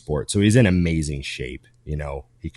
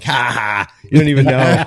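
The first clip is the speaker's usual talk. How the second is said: loud, higher taunting laugh-line; mock gloating; 6 semitones higher, 9 dB louder than usual. Taunting the brother who doesn't know the drug, loud smug gloat. Gloating over naming drugs.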